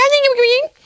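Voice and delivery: very high nonsense whine; mock squeaky YouTuber. Teasing YouTubers with high voices, squeaky mocking babble. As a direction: mimic vloggers with a high mocking gna-gna. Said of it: "qui ont une voix aiguë".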